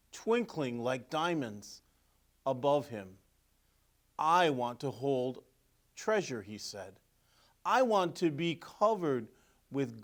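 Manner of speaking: lively storybook narration; reading to children. A narrator's reading. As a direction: read it like a picture book to kids. Reading picture book aloud.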